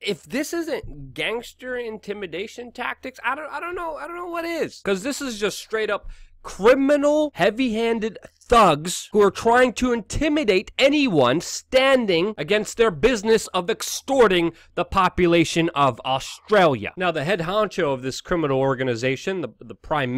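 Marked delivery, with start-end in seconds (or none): 0.1-4.8 exasperated. Real, not acted. Exasperated rant. 4.8-16.9 angry. Real, not acted. Angry rant about thugs.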